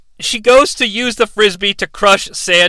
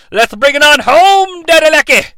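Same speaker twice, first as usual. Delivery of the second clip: loud, higher wrestling-promo voice, shouting; Dusty Rhodes impression; 7 semitones higher, 5 dB louder than usual. Co-host as Dusty Rhodes cutting a promo on the recap, loud and high. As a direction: hype it like an old wrestling legend cutting a promo. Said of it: "[name]'s Dusty Rhodes".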